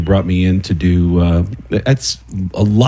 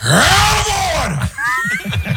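high, drawn-out mock courtroom shouting; escalating hammed-up actor's tirade; Al Pacino; 10 semitones higher, much slower than usual. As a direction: build to a hugely stretched, high-pitched yell. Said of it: "as Al Pacino".

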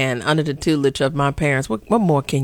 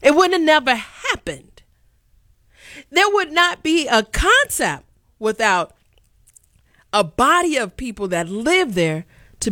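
indignant outburst; 8 semitones higher than usual. Real, not acted. Heated rant continues.

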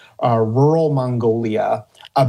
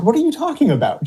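higher, quicker baffled comeback; incredulous retort; 11 semitones higher, faster than usual. Retold or imitated. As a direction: quote yourself baffled, pitched well up. Acted incredulous reply in anecdote.

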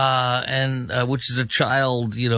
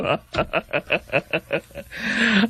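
long, rising cackle; derisive laugh; 5 semitones higher than usual. Real, not acted. Host laughing at the candidate's denial, cackling. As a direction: keep cackling, higher and higher. Laughs after mock belief.

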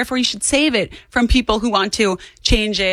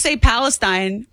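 usual speaking voice

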